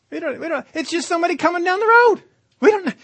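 high mocking sing-song shrug. Preacher mocks crowd shrugging at a stranger, high-pitched. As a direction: toss it off like nobody special. Mock casual indifference.

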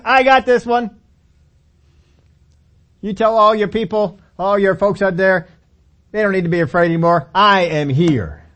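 loud confident brag; swaggering boast. Acted. David boasts to Saul, loud and swaggering.

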